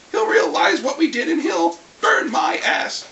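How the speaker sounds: normal talking voice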